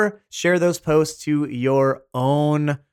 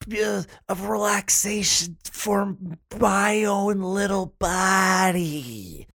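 dreamy cozy voice; 6 semitones higher than usual. Acted. Cozy, dreamy put-on voice before ad.

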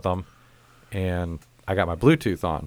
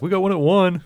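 mock receptionist; 10 semitones higher, 5 dB louder than usual. Receptionist offers bad time.

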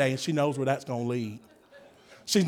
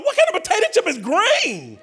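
disbelief; 11 semitones higher, 3 dB louder than usual. Retold or imitated. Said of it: "I thought".

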